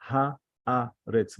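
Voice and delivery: "Haaretz" as ha, a, retz with gaps; split in three. Shown as a demonstration. Teacher showing haaretz is three, syllables separated.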